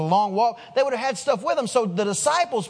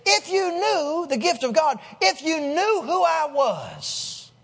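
slow, higher-pitched, drawn-out emphasis; self-proclaiming authority; 5 semitones higher, slower than usual. Acted. Jesus reveals himself to the woman, grandly emphatic. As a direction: declare your own greatness, stretching each word. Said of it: "authorized to talk like that".

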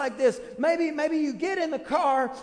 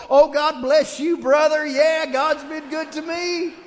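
louder, slow, beaming church hello; overly cheery greeting; 2 semitones higher, 8 dB louder, slower than usual. Retold or imitated. Parent switching to loud cheer for a passing church member. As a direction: put on a big public smile for the greeter.

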